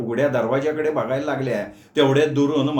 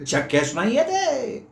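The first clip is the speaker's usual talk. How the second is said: put-on slow, high, firm old uncle; 9 semitones higher, slower than usual. Uncle firmly denies leaving the door open, slow insistent. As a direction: insist slowly it's impossible. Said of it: "मामा ठाम म्हणाले".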